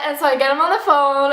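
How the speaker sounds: ordinary talk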